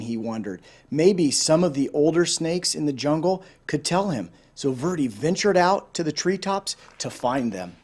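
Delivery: ordinary delivery, nothing marked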